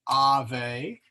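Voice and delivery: Ave said slowly with a long A; slow Latin Ave. Shown as a demonstration. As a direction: draw out the A of Ave. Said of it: "the A is a long A here".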